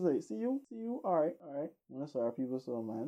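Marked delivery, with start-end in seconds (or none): 0.2-1.7 much higher, wheedling street-beggar voice; threatening beggar. Retold or imitated. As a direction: play the pushy beggar, half-menacing.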